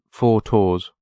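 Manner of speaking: slow Irish-style TOURS, t for th; FOUR TOURS drawn out, Irish th-stopping. Shown as a demonstration. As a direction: demonstrate TOURS with a hard t opening. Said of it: "pronounce it like an Irish person".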